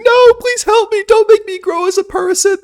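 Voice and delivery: high, loud pleading wail; mock desperate scream. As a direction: scream for help, hammed up. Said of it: "They're screaming".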